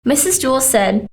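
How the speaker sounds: usual speaking voice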